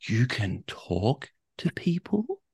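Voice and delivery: slow, soft, wondering rise on "people"; stunned disbelief. Retold or imitated. Ed imitating the shocked sauna guy, slow stunned disbelief. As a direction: play him dumbfounded.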